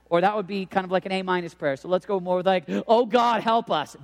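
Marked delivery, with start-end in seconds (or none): 2.9-3.9 loud, higher cry for help; urgent plea. Acted. Preacher modelling a raw campus prayer, loud urgent plea. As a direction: plead it out in one burst.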